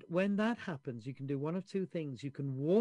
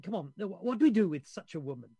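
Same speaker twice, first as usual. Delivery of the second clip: a touch of impatient crowd, louder at the end; 4 semitones higher, 6 dB louder, faster than usual. Retold or imitated. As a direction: push it like an impatient crowd demanding an answer. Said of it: "the crowd gets impatient".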